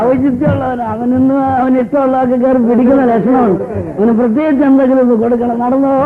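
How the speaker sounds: high, bustling host voice; fussy wedding host. Acted. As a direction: herd the guests in a busy fluster. Host bustles guests about.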